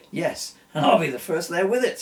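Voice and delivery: triumphantly. A narrator's reading. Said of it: "said Eric triumphantly".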